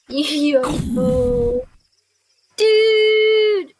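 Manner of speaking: loud, high burst of disbelief; excited. Real, not acted. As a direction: yell it out in disbelief. Player reacting to bad rolls.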